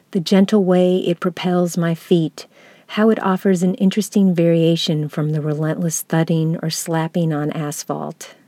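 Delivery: ordinary talk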